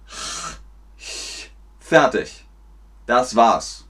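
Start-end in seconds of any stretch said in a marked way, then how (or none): 0.1-1.5 unvoiced, soft fricatives, back then front; ch-sound demo. Shown as a demonstration. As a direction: breathe out each ch sound separately. Laute einzeln vorgemacht. (stimmlose ch-Laute, einzeln vorgemacht)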